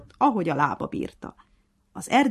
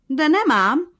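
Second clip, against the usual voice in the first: defiant; 12 semitones higher, 10 dB louder, slower than usual. A narrator's reading. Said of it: "mondta harciasan a hangya".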